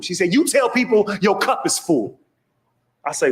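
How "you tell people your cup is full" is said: high, slow, emphatic advice; instructing doctor. Acted. Doctor advising anxious patient, high and deliberate. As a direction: spell it out in a raised pitch.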